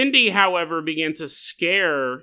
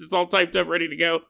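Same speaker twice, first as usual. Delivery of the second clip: normal talking voice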